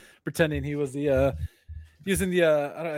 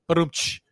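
spoken drum sting "ba-dum-tsh" after a bad joke; mouth rimshot. To his co-host after the pun, a mock drum rimshot. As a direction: voice a comic rimshot.

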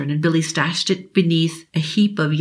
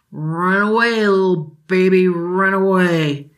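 slow, slurring mocking drunk; slurred taunt; 3 dB louder, slower than usual. Drunk father mocking Billy running away, slurred. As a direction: taunt him as the words slur away. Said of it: "His father's slur trailed off".

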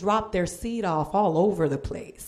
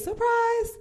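mock surprise. Punchline exclamation.

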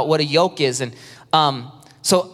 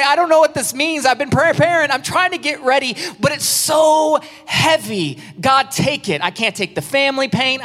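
desperate worshipper; 8 semitones higher than usual. Retold or imitated. Preacher imitating a burdened churchgoer praying, high pleading cry. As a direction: beg God to take the weight. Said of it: "They're like".

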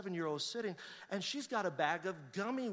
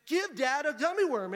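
higher, louder imitation of a bossy sibling; insistent urging; 6 semitones higher, 5 dB louder than usual. Older son telling his sister to share, insistent urging. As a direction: press the child firmly to hand it over.

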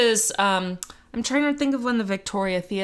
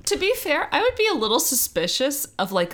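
normal talking voice

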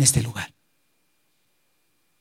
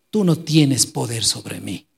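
calm firm answer; 8 semitones lower, slower than usual. Retold or imitated. Jesus answers Pilate calmly.

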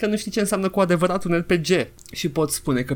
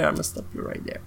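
hushed, unvoiced interjection; whispering a quiet contradiction; whispered correction; 10 dB quieter than usual. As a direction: cut in with a pointed whisper. Whispered correction cutting himself off.

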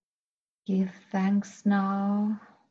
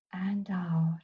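soft breathy whisper on the exhale; 7 dB quieter than usual. Acted.

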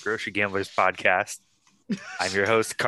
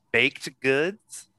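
"baked" stressed, with a question mark after "goods"; 4 semitones higher than usual. Shown as a demonstration. Host announces the week's loose theme, questioning stress on "baked". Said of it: "emphasis on the baked part".

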